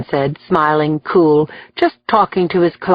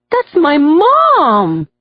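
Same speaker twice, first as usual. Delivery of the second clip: slow, high, wondering; awed at seeing his mother; 8 semitones higher, 3 dB louder, much slower than usual. A narrator's reading. Son spotting his mother in court, in wonder. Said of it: "with wonder in his voice".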